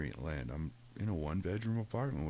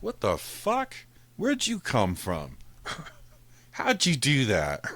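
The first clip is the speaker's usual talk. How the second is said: startled; 6 semitones higher, 6 dB louder, slower than usual. Retold or imitated. Cat pops up from nowhere.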